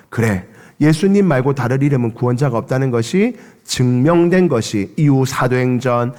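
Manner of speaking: ordinary delivery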